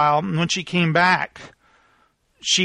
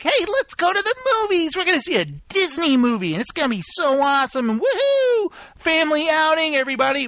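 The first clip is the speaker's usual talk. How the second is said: high-pitched, over-the-top family cheer; hammed-up excitement; 9 semitones higher, faster than usual. Dad hyping the family movie outing, hammed-up excitement. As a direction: gush with mock glee, much higher than usual. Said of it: "Meanwhile, before, we're like".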